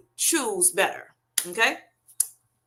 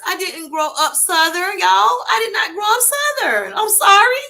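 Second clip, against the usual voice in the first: rising higher, ending in a loud cry of "sorry"; mock exclaiming; 9 semitones higher, 4 dB louder than usual. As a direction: play up the comment's dismay. Reading a viewer's apologetic comment.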